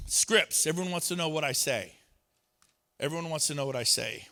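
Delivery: normal talking voice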